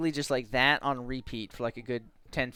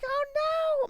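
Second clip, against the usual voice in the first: high, squealed mock panic; hammed-up, high-pitched alarm; 3 dB louder than usual. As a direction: cry out in play-acted dismay.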